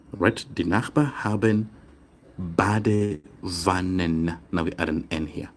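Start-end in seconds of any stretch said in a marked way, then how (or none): 0.5-4.4 Badewannen drawn out very slowly, syllable by syllable; slow, careful model reading. Shown as a demonstration. Teacher modelling German plural endings, slow and deliberate. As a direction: spell out each sound slowly for learners. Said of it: "I'm trying to say it slowly so that we emphasize the pronunciation".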